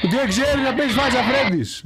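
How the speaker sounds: high-pitched exasperated outburst; exasperated cry. Real, not acted. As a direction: complain in a high, worked-up voice. Exasperated at the player's failure. (αγανακτισμένη κραυγή)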